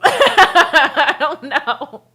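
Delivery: words stretched through laughter; laughing too hard to finish. Real, not acted. To a friend about her sister, laughing helplessly.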